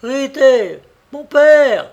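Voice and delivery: high, loud call for help; crying out. A narrator's reading. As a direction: scream for her father as she flees. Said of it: "en criant".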